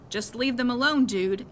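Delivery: ordinary delivery, nothing marked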